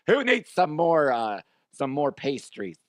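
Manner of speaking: hammed-up, drawn-out flamboyant offer; flamboyant dad. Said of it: "He's very flamboyant".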